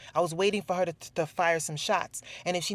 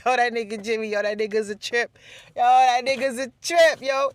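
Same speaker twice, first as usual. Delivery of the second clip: higher, louder mock coworker voice, building; 6 semitones higher, 7 dB louder than usual. Vlogger imitating coworkers laughing about Jimmy, loud and high. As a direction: imitate the guys at work clowning, rising louder. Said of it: "They call Jimmy's name".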